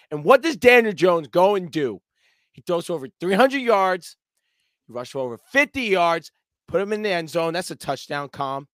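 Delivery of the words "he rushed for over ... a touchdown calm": usual speaking voice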